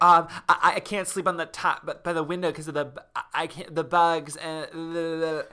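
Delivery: high, stammering hammed-up whine; mock whining. Sarah explains to counselor Richard, mock stammering whine. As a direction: stammer and trail into helpless babble.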